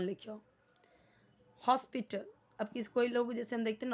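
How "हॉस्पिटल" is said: हॉस्पिटल with a rounded o in the first syllable; correct English pronunciation. Shown as a demonstration. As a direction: say हॉस्पिटल as "hos-pi-tl". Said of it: "English में आप क्या बोलोगे".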